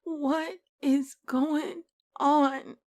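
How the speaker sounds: very slow and high, breaking; dazed despair. Acted. On the bathroom floor, slow stunned plea. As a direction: drag each word out in disbelief.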